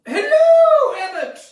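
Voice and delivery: very high, very loud hello; trilling comic greeting. Acted. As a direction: sing out the hello, then drop. Same lady's greeting, performed.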